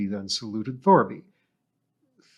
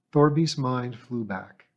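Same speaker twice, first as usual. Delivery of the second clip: usual speaking voice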